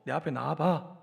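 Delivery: low-pitched, understated order; quiet summons without any consoling; one-sided command. Acted. God summons sulking Elijah from the cave, understated command. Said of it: "오히려 일방적으로".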